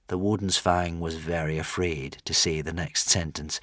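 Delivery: ordinary delivery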